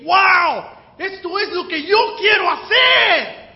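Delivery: recalling first joy, shouting it out high and loud; excited shout. Retold or imitated. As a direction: burst out with the thrill of discovery. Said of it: "recuerdo que la leí por primera vez y dije". (exclamación emocionada, gritando fuerte y agudo)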